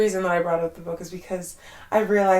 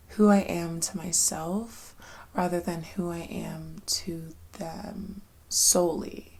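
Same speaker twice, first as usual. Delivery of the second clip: soft, slow whisper; a soft, slow intimate whisper; 5 dB quieter, slower than usual. Real, not acted. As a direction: breathe the words out slowly.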